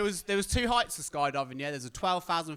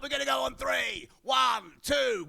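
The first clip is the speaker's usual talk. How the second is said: higher shouted countdown; mock countdown shout; 8 semitones higher, slower than usual. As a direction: shout the count over the wind. Instructor counts down to jump.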